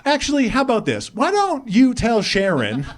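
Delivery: high, heated comeback to a meddling mother; worked-up, defensive son on the phone; indignant retort. Acted. Acted angry reply to his mom.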